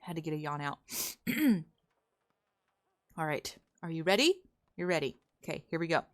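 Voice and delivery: ordinary talk, nothing marked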